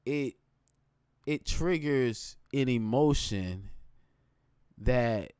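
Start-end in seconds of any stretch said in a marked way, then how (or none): none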